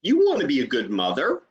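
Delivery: rising question intonation; "You wanna be a good mother?" lifted at the end as a question; question tune. Shown as a demonstration. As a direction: ask it as a real closed question, rising on mother. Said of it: "here's the question".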